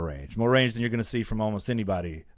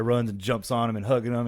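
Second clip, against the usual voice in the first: ordinary talk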